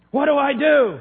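loud, high anguished plea; desperate cry. Retold or imitated. Woman begging the preacher for help, loud despair.

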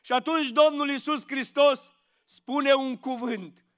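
usual speaking voice

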